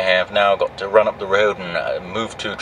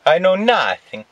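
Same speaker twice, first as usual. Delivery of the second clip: mock innocence; 10 semitones higher, slower than usual. Trucker joking about his lost license, a high comic protest of innocence. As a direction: cry it out like a bumbling guard. Mock Sergeant Schultz line.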